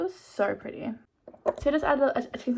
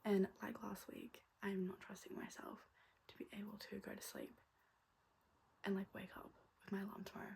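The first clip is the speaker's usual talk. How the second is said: soft late-night whisper; 19 dB quieter than usual. Real, not acted. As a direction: whisper so no one wakes. Late night, all-nighter plans.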